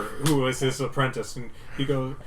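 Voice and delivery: ordinary talk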